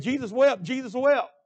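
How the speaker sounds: half-laughing retold shout of a boy; mock boyhood shout. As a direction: belt out the verse like a kid standing his ground. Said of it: "I kept saying".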